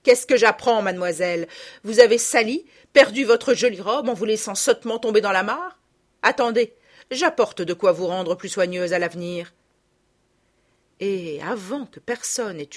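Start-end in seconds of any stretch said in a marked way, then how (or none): 0.0-9.5 loud, raised, indignant tirade; angry guardian's forceful reprimand; furious scolding. A narrator's reading. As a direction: scold the child harshly, with menace. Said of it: "L'apparition furieuse".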